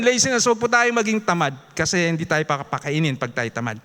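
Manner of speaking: ordinary talk, nothing marked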